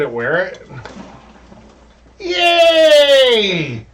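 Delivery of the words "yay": high joyful yelp; excited cheer. Real, not acted. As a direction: cheer it out loud. Cheering the winner beer.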